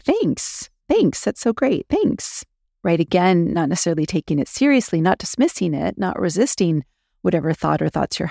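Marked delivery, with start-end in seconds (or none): 0.0-2.5 high, squeaky mock thanks; silly voice. Shown as a demonstration. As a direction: thank your brain in a goofy cartoon voice. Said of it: "You can say it in a silly voice like".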